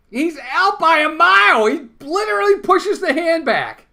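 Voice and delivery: high, loud, incredulous yelling; outraged shout. Real, not acted. Fan arguing with the umpire on TV, high loud shout. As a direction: insist it at the top of your voice.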